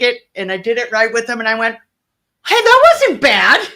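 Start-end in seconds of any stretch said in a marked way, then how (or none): 2.5-3.7 high, loud, drawn-out exclamation; hammed-up tipsy-bright amazement; pleasant surprise. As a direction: blurt it out slowly, pitched way up, after a dreaded shot. Her reaction after downing the tequila.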